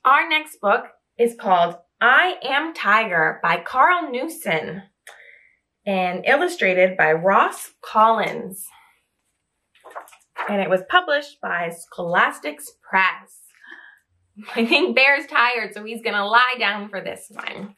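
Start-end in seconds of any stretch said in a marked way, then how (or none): none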